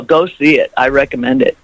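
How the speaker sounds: usual speaking voice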